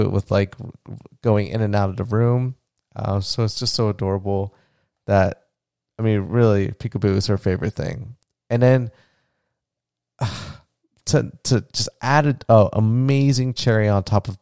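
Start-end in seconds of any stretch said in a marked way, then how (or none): none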